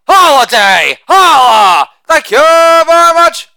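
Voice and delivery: loud sing-song holiday chant; shouted catchphrase. Acted. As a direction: belt the tag line like a party cheer. Catchphrase chant after each joke.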